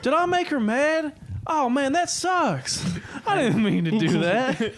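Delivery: high, slow loopy Southern whine; mock Southern regret; slight Southern accent. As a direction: imitate the drugged teen fretting in a Southern accent. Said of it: "slight Southern accent".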